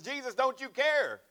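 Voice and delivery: high, loud, drawn-out cry; hollered plea. Acted. Disciples hollering at Jesus in the storm, loud and high. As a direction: holler it over the waves.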